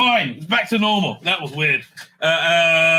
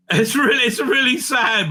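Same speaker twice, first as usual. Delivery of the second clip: amused lament, laughing; 7 semitones higher, 5 dB louder than usual. Real, not acted. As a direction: laugh ruefully while calling it sad. Laughing at the missable range.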